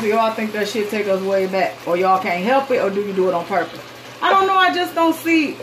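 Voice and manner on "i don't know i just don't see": exasperated outburst. Real, not acted. Rant peaks, pitch jumps.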